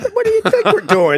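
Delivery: high, laughing tease. Real, not acted. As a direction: toss it off with a laugh. Host teasing guest, laughing.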